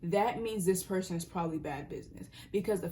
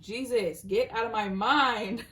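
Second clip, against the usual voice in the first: louder, slow, pleading exclamation; exasperated outburst; 4 semitones higher, 7 dB louder, slower than usual. Real, not acted. Tarot reader to an intrusive memory, loud exasperated plea. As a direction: shoo the thought away loudly. Unwanted memory pops into her head.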